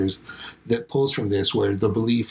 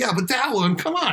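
pleading; 11 semitones higher than usual. Retold or imitated. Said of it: "and I was like".

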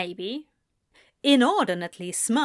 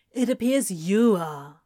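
flatly; 4 dB louder than usual. A narrator's reading. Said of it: "Crowley says flatly".

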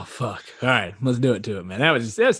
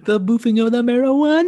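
higher, relishing repeat of the boofing of the marijuana; teasing echo, a bit higher; mock savoring; 10 semitones higher than usual. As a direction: savor the phrase, marijuana rising. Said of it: "It sounds like you're about to have an orgasm".

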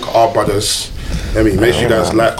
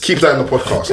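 usual speaking voice

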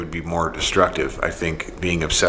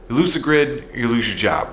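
put-on Canadian New York accent; 5 semitones higher than usual. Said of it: "a New York Canadian accent".

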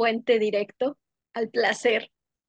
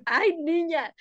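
grandma's sigh; 6 semitones higher, slower than usual. Retold or imitated. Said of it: "mi abuelita nada más me dice".

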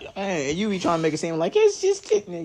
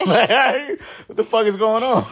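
laughing disbelief; 6 semitones higher, 7 dB louder, slower than usual. Retold or imitated. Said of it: "I be like".

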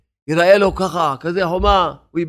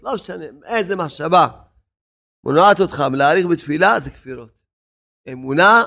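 ordinary talk

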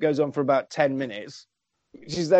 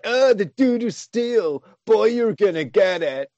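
put-on Alan's sneering teen voice; 3 semitones higher than usual. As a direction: sneer it like a smug eighties brat, stretched out. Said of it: "That's how he talks for the whole fucking film".

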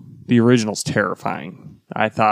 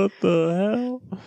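mock shock; 10 semitones higher, 3 dB quieter, much slower than usual. Imagined daycare reaction.

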